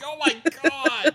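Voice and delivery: high, loud, drawn-out exclamation; mock shocked outburst. Host acts out the mix-up reaction, mock shocked.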